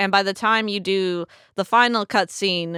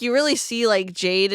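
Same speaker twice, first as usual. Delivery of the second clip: normal talking voice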